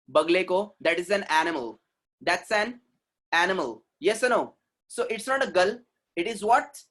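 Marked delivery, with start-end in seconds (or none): none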